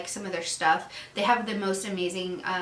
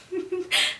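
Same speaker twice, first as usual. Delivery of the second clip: short high giggle; 10 semitones higher than usual. Real, not acted. Giggling about poop to viewers, high giggle.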